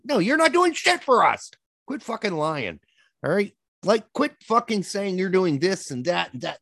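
high, fed-up rant at the ads. Real, not acted. Podcast host rants at car commercials, angry and high. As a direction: fire it straight at the advertisers. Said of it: "rant for the end of the night".

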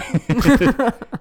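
high bursting laugh; loud burst of laughter. Real, not acted. Laughs at own boast.

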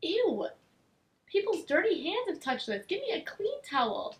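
disgusted. Retold or imitated. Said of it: "I was like".

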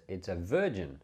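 ordinary talk

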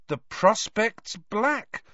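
very slow, high, dreading line; drawn-out appalled recital; horror-struck reciting. A narrator's reading. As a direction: recite it slowly as the horror dawns. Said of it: "Harry recited, staring, horror-struck".

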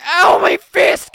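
high, loud, drawn-out wail of pain; pained yell. Retold or imitated. Kid who punched brother's braces, yelping in pain. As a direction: howl it slowly, way up high.

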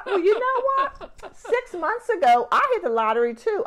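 high, loud mock voice of a bragging friend; one-upping friend. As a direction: top her news in a shrill-free high put-on voice. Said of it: "it would be like".

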